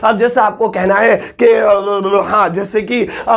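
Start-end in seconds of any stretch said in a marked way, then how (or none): none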